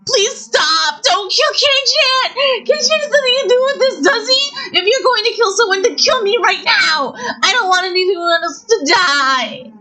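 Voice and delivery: crying out to save him; girl wails pleading for his life; wailing. Acted. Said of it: "as she wailed".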